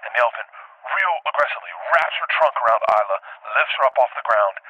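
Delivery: ordinary talk, nothing marked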